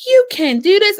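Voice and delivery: mock the amazed followers; amazed commenters. Coach imitating excited social media followers. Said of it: "people are sitting here like".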